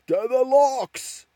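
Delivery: high, loud, drawn-out rallying shout; mob yell. A narrator's reading. One of the mob rallies the others, yelling. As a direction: yell it out long over the crowd. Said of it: "one of them yelled".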